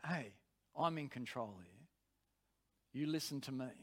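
low, soft command; quiet authority. Retold or imitated. Preacher's spirit orders the flesh to listen, low and quiet. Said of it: "But the voice of my spirit".

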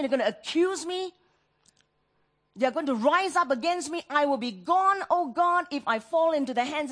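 urgent plea. Acted. Psalmist pleading about enemies.

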